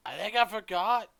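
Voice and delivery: high, loud, lilting up at the end; forgetful shopkeeper. Acted. Shopkeeper losing track before a customer, high and loud. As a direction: pitch up into a scatterbrained character voice.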